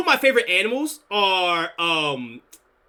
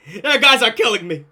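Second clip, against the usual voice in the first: said through real laughter; cracking up at the chat; laughing; 2 semitones higher than usual. Real, not acted. Streamer laughing at chat comments.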